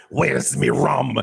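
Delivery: slow, drawn-out pirate demand; hammed-up old pirate voice. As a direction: growl it out as a storybook pirate. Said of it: "The same pirate".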